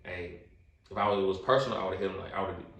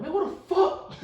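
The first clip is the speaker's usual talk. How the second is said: squeaky, much higher yelp with a laugh; high pitched voice; 6 dB louder than usual. Retold or imitated. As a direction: let it squeak up high in shock. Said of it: "I got a high pitched voice too".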